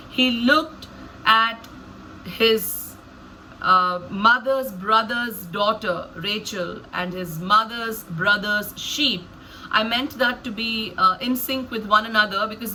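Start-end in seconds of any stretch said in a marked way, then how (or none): 0.1-9.3 slow, drawn-out storytelling with long pauses; deliberately slowed. Shown as a demonstration. Explaining how they narrate Jacob seeing Rachel, deliberately slow. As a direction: linger over each phrase, pausing between words. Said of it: "I deliberately slow down there and I say".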